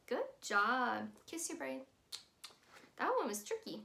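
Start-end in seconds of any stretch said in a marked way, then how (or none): none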